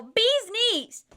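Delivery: high, loud excited yell; calling out. Retold or imitated. Gucky spotting the bee tattoos, shouting high and loud. Retold shout across to speaker.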